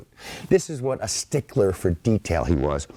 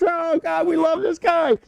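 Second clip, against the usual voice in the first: much higher, laughing-along gush; delighted soldiers. Retold or imitated. American soldiers cheering von Steuben, high delighted gush. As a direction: gush like soldiers who love their new drillmaster. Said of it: "the Americans are doubled over".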